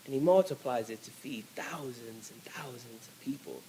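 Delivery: hushed whisper of wonder. Acted. Preacher whispering to the congregation. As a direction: drop to a whisper for the miracle.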